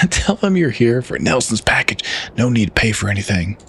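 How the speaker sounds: mild Southern uncle, half laughing; Southern accent. Acted. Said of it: "I gotta do my southern voice".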